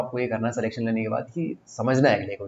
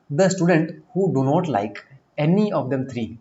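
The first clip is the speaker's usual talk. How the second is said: very slow, louder read of the question; slow deliberate dictation; 3 semitones higher, 4 dB louder, much slower than usual. Shown as a demonstration. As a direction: spell the words out slowly for the class. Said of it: "reads question slowly".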